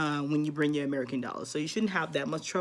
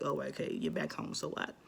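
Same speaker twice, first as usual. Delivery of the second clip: mock indifference; 2 semitones lower, 8 dB quieter than usual. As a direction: imitate an unimpressed local brushing someone off. Acting out indifferent locals' reply.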